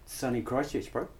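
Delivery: ordinary talk, nothing marked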